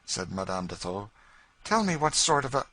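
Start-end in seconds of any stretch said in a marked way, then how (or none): 1.6-2.7 eager lady. Acted.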